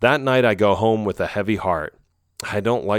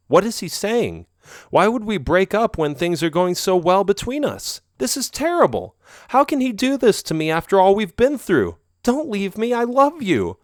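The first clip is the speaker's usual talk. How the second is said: rising, pleading inner panic, much higher; panicking; 11 semitones higher than usual. Acted. She fears he is breaking up, panicked pleading. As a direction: spiral into desperate thoughts.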